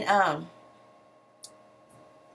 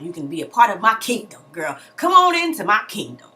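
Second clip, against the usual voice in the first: grand, louder welcome into the kingdom; welcoming queen mother; 2 semitones higher, 7 dB louder than usual. Retold or imitated. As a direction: invite her in like a proud matriarch. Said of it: "She tells Bambi".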